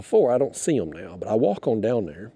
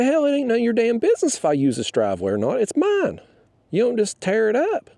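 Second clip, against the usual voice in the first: much higher, fast, heated retort; retold angry yell; 10 semitones higher than usual. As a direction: bark it back at the neighbor in a fury. Retold shouting at neighbor.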